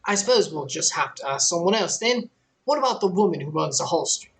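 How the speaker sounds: put-on light Australian accent that keeps slipping; a player character asking about a woman in a faltering Australian accent. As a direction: try for Australian, half-held. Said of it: "I'm trying to do Australian".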